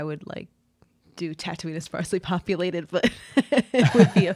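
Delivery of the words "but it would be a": laughing. Real, not acted. Laughs at her own bad accent.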